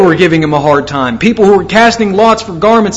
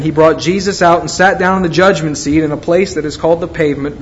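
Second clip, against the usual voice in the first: usual speaking voice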